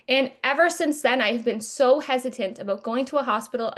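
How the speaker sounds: ordinary talk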